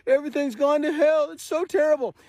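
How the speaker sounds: high, hammed-up whining lament; mock crying complaint. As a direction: whine it like a crybaby feeling sorry for himself. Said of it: "then cry in your millie meal".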